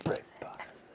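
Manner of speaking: breathy stage whisper in a put-on Spanish accent; whispered fake Spanish; mock Spanish whisper. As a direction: hiss it like a dramatic announcer in Spanish. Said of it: "I told you I'm gonna talk like that in Spanish".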